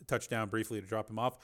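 plain reading voice

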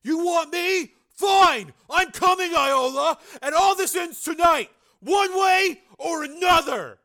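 high angry shout at nobody; furious shout into the empty night; voice rising to a shout. A narrator's reading. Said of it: "Joe's voice rose".

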